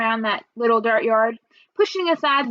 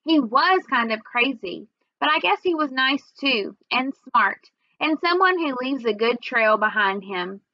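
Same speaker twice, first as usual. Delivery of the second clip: ordinary delivery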